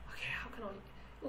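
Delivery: whispering while searching for words; whispered to self. Real, not acted. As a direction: think aloud under the breath. Thinking aloud how to explain.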